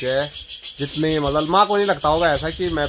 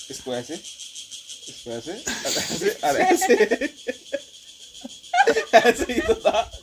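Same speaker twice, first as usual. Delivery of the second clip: laughing through the words; breaking into laughter mid-try; 8 semitones higher, 5 dB quieter, slower than usual. Real, not acted. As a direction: giggle while explaining how. Laughing while trying the challenge.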